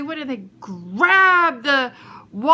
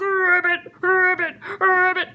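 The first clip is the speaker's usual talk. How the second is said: giant frog croak. Acted. Storyteller plays the giant frog, croaking.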